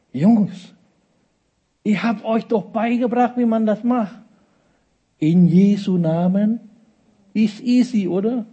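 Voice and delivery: high-pitched, playful chiding; hammed-up exasperated teacher; mock exasperation. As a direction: chide the lads as if it were obvious. Imagined Jesus chiding his disciples.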